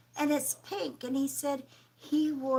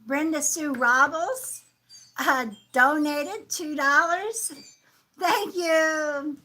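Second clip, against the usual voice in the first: usual speaking voice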